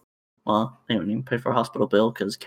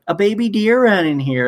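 higher and louder, breathless customer; mock frantic lady; 8 semitones higher, 5 dB louder than usual. Customer tells the worker a deer ran in, high and urgent. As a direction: play the flustered shopper blurting it out.

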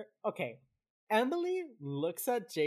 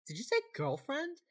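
high-pitched incredulous question; mock surprise; 8 semitones higher than usual. JJ reacting to Tara having a girlfriend, mock surprised. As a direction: act out a startled double take. Acted JJ startled by the news.

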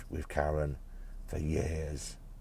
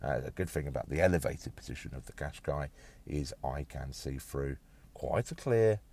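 ordinary talk

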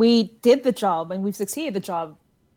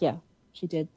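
softer, choked-up agreement; racked with emotion; 3 semitones lower, 8 dB quieter than usual. Acted. Nodding about Stacy's death, softly choked up. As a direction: barely hold it together. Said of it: "racked with emotion right now".

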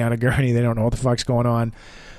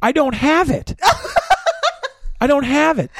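high-pitched, incredulous protest; indignant denial; 12 semitones higher than usual. Retold or imitated. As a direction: insist on it in a pitched-up voice. Protests the wrong diagnosis.

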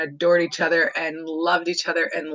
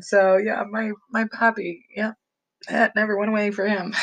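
ordinary talk

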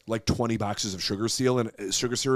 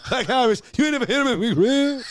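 much higher, louder comic bark; Bernie Mac line; 11 semitones higher, 5 dB louder than usual. Retold or imitated. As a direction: nail the movie quote in his voice. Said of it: "say his line from Charlie's Angels".

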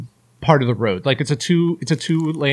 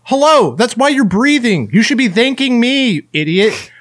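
higher, louder, slow indignant retort; mock furious rant; 7 semitones higher, 4 dB louder than usual. Retelling what he wanted to tell the neighbor, indignant and loud. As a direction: spit it back at her, slowly, fed up. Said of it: "I got so mad".

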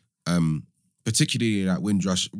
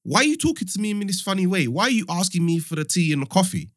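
mock-indignant, pitched much higher; incredulous retort; 9 semitones higher than usual. To European colleagues, a high, incredulous challenge. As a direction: act baffled and offended at the question. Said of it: "I was like".

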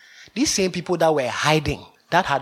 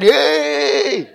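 mock cheer; 3 semitones higher, 7 dB louder than usual. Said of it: "the rest of them shouted".

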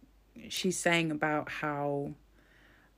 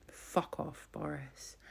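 breathy, softer fuck off under the breath; whispered insult; 2 semitones lower, 11 dB quieter, slower than usual. Real, not acted. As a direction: mutter it to yourself in disgust. Muttered insult at Boris.

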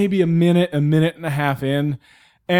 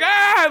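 frustrated yell; 3 dB louder than usual. Retold or imitated. Frustrated outburst.

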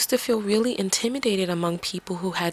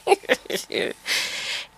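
high breathy laugh; 13 semitones higher than usual. Real, not acted. Laughing at own accent slip.

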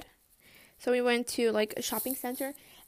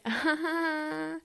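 long high laugh; 6 semitones higher than usual. Real, not acted. Vlogger laughing at giving up on everything, high drawn-out laugh. Laughs awkwardly at own story.

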